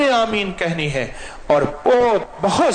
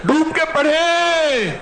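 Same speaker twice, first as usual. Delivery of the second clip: fervent preaching; 9 semitones higher than usual. Acted. Imam urging worshippers to weep while reciting, fervent. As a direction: urge them on with full force. Urges congregation at full force.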